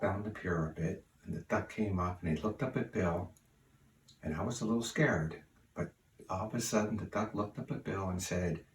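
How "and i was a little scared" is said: usual speaking voice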